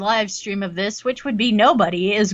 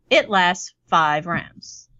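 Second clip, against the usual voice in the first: very slow, drawn out; slow motion; 5 semitones lower, much slower than usual. Pretend. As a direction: stretch every word out. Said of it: "I'm only talking in slow-mo".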